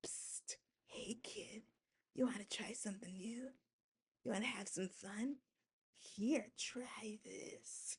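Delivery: unvoiced, very soft pusher's come-on; shady pusher whisper. A dealer in an old ad whispering to a kid. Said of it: "like those commercials back in the day".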